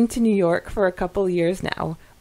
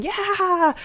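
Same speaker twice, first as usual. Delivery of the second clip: excited cheer; 13 semitones higher than usual. Retold or imitated. Podcast host retelling the group's vote, high excited cheer. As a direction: cheer like the whole group voting at once. Group voting yes on accent.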